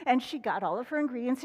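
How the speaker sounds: ordinary delivery, nothing marked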